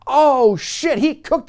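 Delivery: loud, high oh shit; excited. Real, not acted. Viewer reacting to the roast, excited. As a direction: react to a savage burn.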